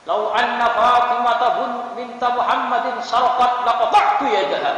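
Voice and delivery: forceful prophetic intonation. Retold or imitated. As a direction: thunder it out as a stern ruling. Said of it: "intonasinya begitu".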